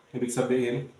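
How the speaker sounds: ordinary talk, nothing marked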